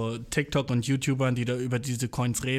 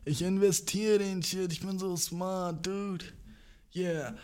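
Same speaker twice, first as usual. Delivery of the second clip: smug crypto bro; slower than usual. Retold or imitated. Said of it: "du bist so".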